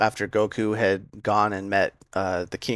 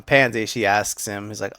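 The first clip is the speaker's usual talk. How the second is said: ordinary talk, nothing marked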